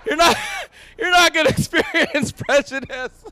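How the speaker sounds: usual speaking voice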